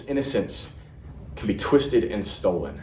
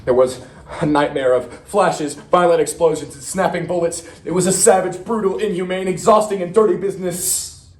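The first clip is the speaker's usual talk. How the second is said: raised, urgent, high-pitched combat telling; intense battle account; 6 semitones higher, 3 dB louder than usual. Acted. Marine reliving the beach landing under fire, urgent and intense. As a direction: push each horror out with mounting urgency.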